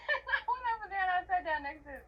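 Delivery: high, laughing narration. Real, not acted. Telling how they sat by his dad, laughing. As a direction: tell it while really cracking up.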